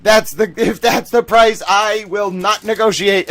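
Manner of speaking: loud, high mock outburst; indignant outburst. Streamer replays refusing a pay cut, indignant outburst. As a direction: storm out of a negotiation at full volume. Acted walkout over money.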